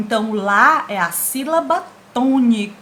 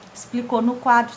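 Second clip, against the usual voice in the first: ordinary delivery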